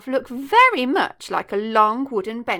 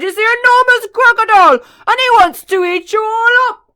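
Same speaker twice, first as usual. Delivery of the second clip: bellowing; 11 semitones higher, 15 dB louder than usual. Acted. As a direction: bellow the warning. Chunky keeps bellowing warning.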